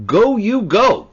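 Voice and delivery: "Go, you go" called out loud, high and slow; a loud, slow proclamation of "Go, you go"; emphatic verb. Shown as a demonstration. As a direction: hammer both "Go" words like a summons. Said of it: "we have emphasis of the verb".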